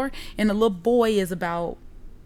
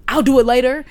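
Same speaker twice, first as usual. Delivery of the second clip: loud, higher dismissive reply; retold blow-off; 4 semitones higher, 8 dB louder than usual. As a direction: mimic brushing someone off. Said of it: "that blow off bothered me".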